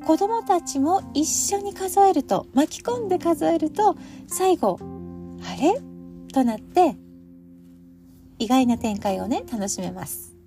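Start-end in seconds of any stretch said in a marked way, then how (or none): 5.4-5.8 higher, questioning "huh?" with a sharp rise; puzzled surprise. Acted. Children reaching an unexpected end of the count, puzzled surprise. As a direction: act out a child's surprised double-take.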